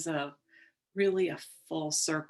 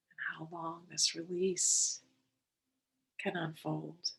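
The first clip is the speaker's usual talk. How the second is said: unhurried, calm and measured, settling them in; guided meditation; 4 dB quieter than usual. Real, not acted. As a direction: guide listeners gently into the breath, leaving space between phrases.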